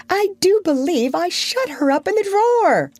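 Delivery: very high, giddy character voice; gleeful. A narrator's reading. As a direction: confess it with bubbling glee. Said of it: "he whispered gleefully".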